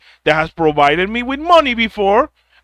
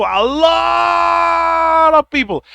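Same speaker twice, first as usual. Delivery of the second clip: slow, higher, stretched out; drawn-out emphasis; 8 semitones higher, much slower than usual. Real, not acted. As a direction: lean hard on "a lot of people".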